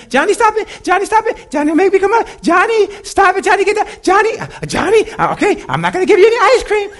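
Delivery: very high, loud hammed-up pleading parent; frantic falsetto scolding of a child; nagging parent.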